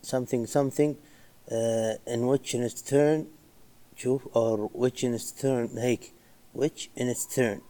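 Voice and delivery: slow, halting, groping for words; a mild mock stammer, drawn out; reenacted stammer. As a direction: stumble through it like a nervous presenter. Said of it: "في جزء هنا تلعثمت".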